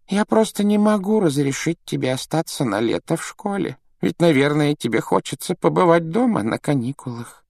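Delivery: put-on high-pitched frail old man's voice; kindly old headmaster, refusing mildly; gentle old headmaster. A narrator's reading. Headmaster refuses to let the boy stay, gently.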